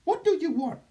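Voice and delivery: high alien reptilian voice; otherworldly being, high and strange; Doctor Nucky. Acted. Channeled being speaks.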